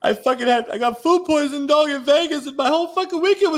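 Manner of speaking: fake-bawl it like a spoiled kid; mock crying. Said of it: "I'm crying".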